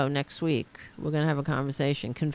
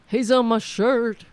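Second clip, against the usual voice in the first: raised, much louder announcement; loud repeat; 5 semitones higher, 9 dB louder than usual. Real, not acted. Streamer telling viewers about the sleeping cat, loudly. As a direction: call it out loud and higher.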